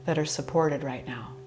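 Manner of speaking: calm yoga nidra guidance with long pauses; guided meditation. Acted. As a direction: ease them into relaxation, leaving space between phrases. Leads a yoga nidra relaxation.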